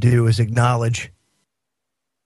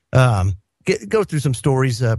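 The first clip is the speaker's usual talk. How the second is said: normal talking voice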